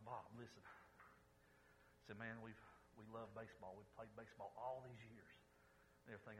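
soft and hushed. Acted. Jim quietly pleading with his dying friend Bob, hushed. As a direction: talk low and gently at the bedside.

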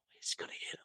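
unvoiced worried whisper; whispered thought. Watching Dylan swing the guitar, alarmed whisper. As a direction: breathe it like an alarmed inner thought. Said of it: "I think".

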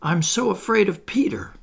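high, fearful confession; understated frightened voice; shaking fear. A narrator's reading. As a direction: say it high, with a touch of dread. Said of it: "he answered, shaking".

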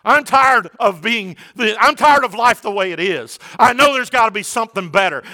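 weary world pleading, voiced with a preacher's force; crying out. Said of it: "a world that is crying out".

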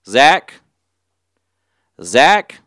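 loud, rising questioning shout; calling for someone. Retold or imitated. Cashier searches for absent customer.

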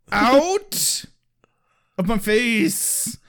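high, drawn-out exaggerated order; mock indignant yell. Irate admin dismissing students, mock indignant. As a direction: stretch each word in fake outrage.

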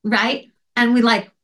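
ordinary delivery

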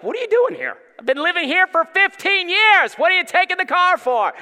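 high, loud squawky bird voice, offended; the bird scolding the intruder; indignant bird. Retold or imitated. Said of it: "Bird is like".

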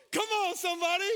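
fired-up preacher call; exhorting shout. Real, not acted. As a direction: rouse the congregation.

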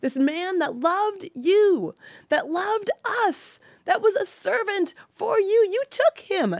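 high, loud railing at God; impassioned, grief-stricken protest; anguished angry rant. Retold or imitated. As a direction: cry out in outrage, stretching the accusing words. Acted rant at God over husband's death.

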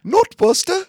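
very high, loud, badly faked British; terrible British accent. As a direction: shriek it in a hammed-up British accent. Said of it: "that's a terrible British accent".

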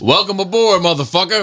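high-pitched sarcastic ship greeting; mock welcome. Crewman greeting passengers on a shabby ship, mock welcome. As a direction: belt out a rude, high welcome aboard.

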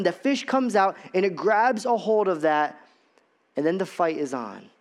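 usual speaking voice

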